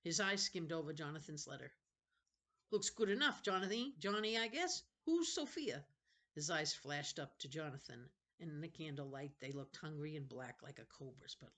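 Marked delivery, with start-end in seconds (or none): 2.7-5.9 higher, louder mocking sneer; a bully sneering at the new boy, higher and louder. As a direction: taunt him with a sly grin. Benny voiced, sneering.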